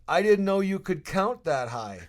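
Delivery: hammed-up jab; teasing owner. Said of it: "Bruce McNall says to me".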